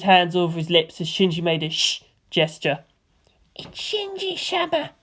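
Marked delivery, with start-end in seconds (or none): 3.5-4.9 high, slow, hushed awe; awed hush. A narrator's reading. Kid recognises Shinji, high hushed awe. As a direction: breathe it in wonder.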